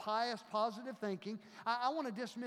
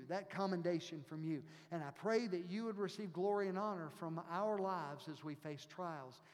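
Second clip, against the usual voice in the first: pastoral prayer; 4 semitones lower than usual. Acted. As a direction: pray over the congregation. Leads a closing prayer.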